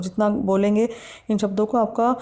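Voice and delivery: normal talking voice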